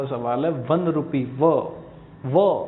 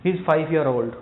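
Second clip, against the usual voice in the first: ordinary delivery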